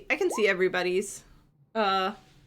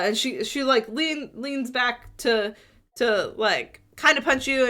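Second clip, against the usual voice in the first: ordinary talk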